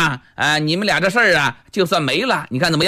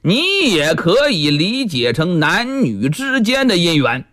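plain reading voice

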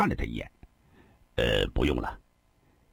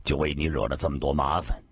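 weary sigh; 6 semitones lower than usual. A narrator's reading. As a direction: sigh out the guilt to the elder brother. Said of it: "长叹一声".

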